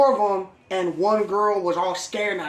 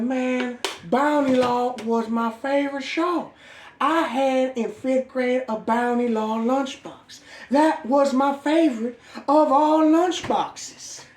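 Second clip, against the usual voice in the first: higher, slow dopey fan voice; starstruck fan; 6 semitones higher, slower than usual. Retold or imitated. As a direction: gush slowly like a starry-eyed kid. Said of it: "He like".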